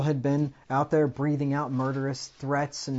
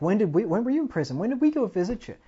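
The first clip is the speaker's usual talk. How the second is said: incredulous protest; 9 semitones higher, 5 dB louder, faster than usual. Retold or imitated. Goats protesting to Jesus, quoted.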